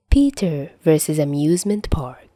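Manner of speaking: usual speaking voice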